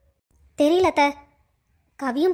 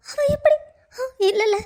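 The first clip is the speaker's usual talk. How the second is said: higher, crying denial; tearful protest; 7 semitones higher than usual. Acted. Raji defends her friends against suspicion, tearful protest. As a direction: refuse to believe it, through tears.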